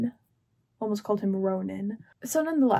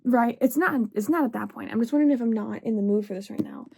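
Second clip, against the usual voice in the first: ordinary delivery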